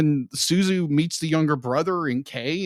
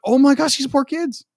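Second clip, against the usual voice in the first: pitying gasp; 9 semitones higher than usual. Retold or imitated. Retelling her reaction to the film.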